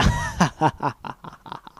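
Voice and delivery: a villain's wild, gloating cackle; maniacal laugh. Acted. Villain mare gloating over her rainbow plan, maniacal laugh. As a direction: throw the head back and cackle madly. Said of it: "laughed maniacally".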